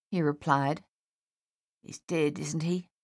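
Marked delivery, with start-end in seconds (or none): 1.8-2.9 understated gruff question. A narrator's reading. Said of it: "Dave said gruffly".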